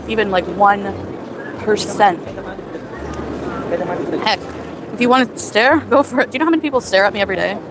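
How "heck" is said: usual speaking voice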